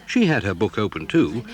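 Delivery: normal talking voice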